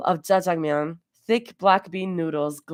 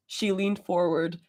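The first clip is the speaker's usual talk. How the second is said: ordinary delivery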